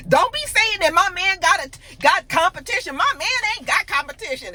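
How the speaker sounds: high, loud mock indignation; offended. Jazzy defending her man after a tease, offended. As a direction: snap it back high, imitating someone offended. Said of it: "Jazzy really took offense to it".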